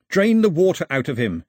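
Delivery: loud, urgent yell. A narrator's reading. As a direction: bark the order across the canoe. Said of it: "he yelled".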